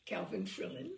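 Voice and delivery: plain reading voice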